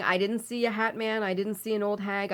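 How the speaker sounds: normal talking voice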